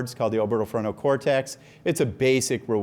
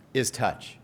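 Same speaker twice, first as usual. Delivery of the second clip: ordinary talk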